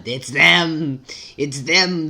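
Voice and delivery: loud, drawn-out "them"; loud exclamation, stretched out; excited cry. Acted. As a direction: cry it out with the last word stretched. Acted sighting, calling out.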